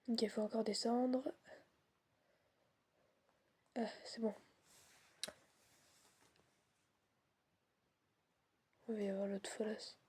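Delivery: frightened whisper; whisper, dreading what's coming; scared horror game streamer. Real, not acted. Fearing the enemy nearby.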